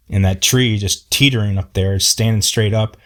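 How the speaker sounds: ordinary delivery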